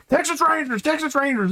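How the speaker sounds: high repeated call; announcing guard. Acted. Guard announcing Texas Rangers, high call. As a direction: call out who they are.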